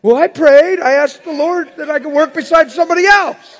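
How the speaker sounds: high, loud laughing mock whine; mock self-justifying. Preacher mocking a churchgoer's excuse, loud laughing whine. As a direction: lampoon the smug complainer. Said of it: "you call that prayer".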